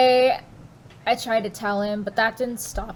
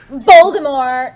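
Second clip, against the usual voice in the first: dramatic reveal; 2 semitones higher, 13 dB louder than usual. Acted.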